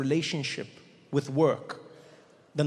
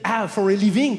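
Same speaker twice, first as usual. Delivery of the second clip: put-on loud, higher French-accented echo; 5 semitones higher, 8 dB louder than usual. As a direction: repeat it back in a French accent. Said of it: "go up to a French person".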